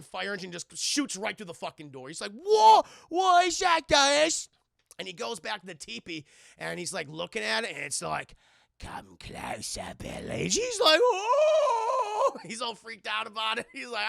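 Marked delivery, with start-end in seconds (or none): 2.3-4.5 high, loud alarmed shout; hammed-up startled kid; startled yell. Acted startled reaction to fire engine. 8.8-10.4 slow, eerie whispery lure; drawn-out sinister whisper; creepy ghost voice. Retold or imitated. Teepee voice lures the boy. 11.0-12.4 long, high, loud wail; hammed-up scared "whoa"; mock terrified cry. Boy's reaction to ghost voice.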